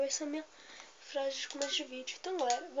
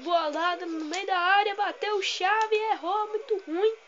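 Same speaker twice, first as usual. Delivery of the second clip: loud, raised football commentary; excited play-by-play; 5 semitones higher, 8 dB louder, slower than usual. Real, not acted. Gamer commentating his own corner, loud excited call. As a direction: call the attack loudly, pitched up.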